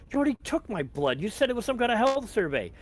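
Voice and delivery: high, loud indignant objection; indignant protest. Acted. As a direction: protest with rising pitch, incredulous. Detained man protests officer's accusation.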